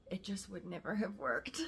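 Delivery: usual speaking voice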